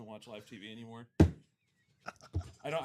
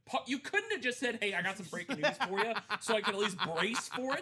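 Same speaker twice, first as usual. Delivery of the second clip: higher, louder mock exasperation with a laugh; exasperated retort; 9 semitones higher, 7 dB louder than usual. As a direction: throw it back at your buddy, half laughing. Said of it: "I'm like".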